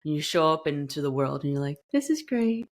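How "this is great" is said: fake cheerful. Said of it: "that you're being fake".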